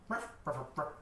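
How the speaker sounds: short mock dog barks. Droid talking to the dog, imitated barking. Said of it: "You hear it look down at the dog and go".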